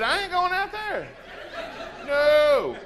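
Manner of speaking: high, loud mock refusal; defiant yell. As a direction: bellow the refusal like a kid who won't budge. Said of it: "I said that in my head".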